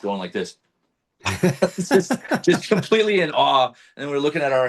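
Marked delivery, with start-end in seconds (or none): 1.2-1.8 high delighted laugh. Real, not acted. Laughing while recalling the trip. 1.9-3.7 laughing. Real, not acted. Laughing while recalling the trip.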